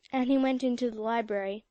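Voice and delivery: ordinary delivery, nothing marked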